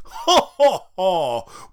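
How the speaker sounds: loud hearty ha ha ha; booming jolly cackle; Santa laugh. Acted. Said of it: "My name's Sweary Santa".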